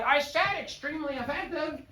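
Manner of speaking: high, put-on voice; high mock insistence. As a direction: say it like a stubborn student. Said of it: "Your heart and your mind will tell you it's a slide".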